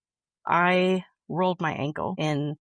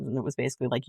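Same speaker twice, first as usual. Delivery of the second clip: ordinary delivery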